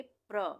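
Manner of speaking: प्र with a half प run straight into a full र. Shown as a demonstration. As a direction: say प्र as one cluster, no vowel after प. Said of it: "प आधा आ रहा है". (प्र में आधा प, पूरा र)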